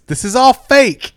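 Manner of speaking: acted outraged scream, stretched out; mock scream. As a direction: shout it slowly like a ringside heckler. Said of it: "and screams".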